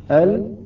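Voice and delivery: ال pronounced as an isolated demo; demonstrated lam. Shown as a demonstration. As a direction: sound out the lam of ال by itself. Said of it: "يكون على مخرج اللام".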